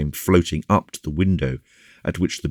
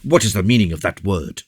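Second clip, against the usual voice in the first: raised, affronted demand; proud, higher-pitched protest; indignant; 5 semitones higher, 3 dB louder than usual. A narrator's reading. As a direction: challenge him with haughty offence. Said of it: "replied Porthos with flashing eyes".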